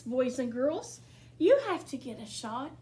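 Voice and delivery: ordinary delivery, nothing marked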